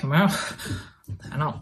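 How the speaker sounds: mock unenthused reluctance; low-energy groan. Audience turned off by a low-energy pitch, mock reluctant groan. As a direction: groan it as if you can't be bothered. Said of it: "with really low energy, therefore they're like".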